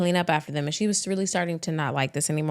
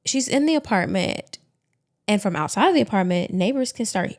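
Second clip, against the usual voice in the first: ordinary delivery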